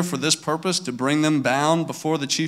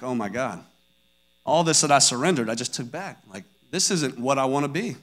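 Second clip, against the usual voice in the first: retold regret; 4 semitones lower, 6 dB quieter than usual. Retells his sudden regret.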